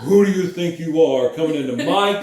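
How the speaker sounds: loud, hammed-up outrage; mock indignant professor. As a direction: tear into the student in mock fury. Acts angry professor confronting student.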